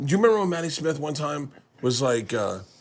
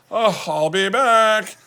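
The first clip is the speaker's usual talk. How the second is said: high, slow, drawn-out sigh of a waiter; mock a fed-up waiter dragging the words; exasperated waiter; 9 semitones higher, 5 dB louder, much slower than usual. Waiter voiced leaving table.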